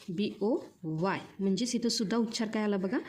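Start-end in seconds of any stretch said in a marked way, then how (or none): 0.1-1.2 slow, separate letters, each one clear; spelled out letter by letter. Shown as a demonstration. Teacher spelling boy for young students, slow and clear. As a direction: spell it slowly for the class. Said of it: "काय आहे बघा?".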